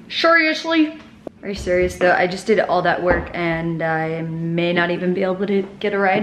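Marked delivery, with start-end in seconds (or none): 0.1-1.0 high, loud exasperated seriously; exasperated disbelief. Real, not acted. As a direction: whine it in disbelief. Rising exasperated repeat.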